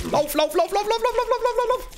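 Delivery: high, loud panicked yelling; panicked shouting. Real, not acted. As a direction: scream at yourself to run. Fleeing enemy in game.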